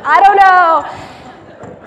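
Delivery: very loud, high, slow laughing "I don't know"; drawn-out laughing whine. Retold or imitated. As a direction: stretch it out in a loud laughing wail. Retold reply to Connor.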